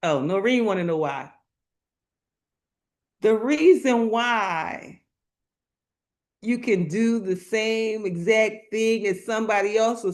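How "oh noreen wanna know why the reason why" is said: ordinary talk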